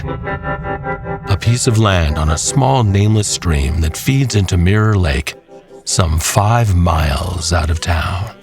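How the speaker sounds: normal talking voice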